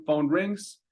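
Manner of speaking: ordinary delivery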